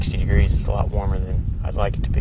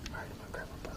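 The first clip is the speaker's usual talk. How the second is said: very faint breathy whisper; whisper, hardly a sound; wildlife watching whisper; 21 dB quieter, much faster than usual. Real, not acted. Hunter readies bow, deer close.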